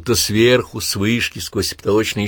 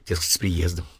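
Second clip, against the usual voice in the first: ordinary delivery, nothing marked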